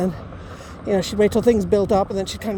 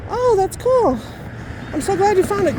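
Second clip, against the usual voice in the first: retold phone reaction; 9 semitones higher than usual. Daughter answering dad on the phone, retold gushing. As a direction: coo approvingly, much higher than usual. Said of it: "yeah, that's my dad".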